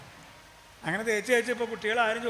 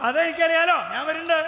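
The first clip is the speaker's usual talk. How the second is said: proud king; 6 semitones higher, 5 dB louder than usual. Acted. King replies loudly.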